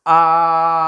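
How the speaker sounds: आ held long, loud and level; long held vowel. Shown as a demonstration. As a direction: sustain आ on one steady note. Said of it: "आ का उच्चारण मैं कर सकता हूँ". (ज़ोर से लंबा खींचा गया आ)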